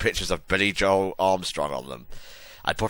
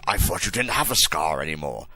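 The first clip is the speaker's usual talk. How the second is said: ordinary delivery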